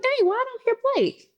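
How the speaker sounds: high, puzzled "dang" to herself; puzzled surprise. Retold or imitated. Mom coming downstairs, puzzled at the silence, voice up high. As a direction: voice jumps up, wondering aloud. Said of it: "I'm like".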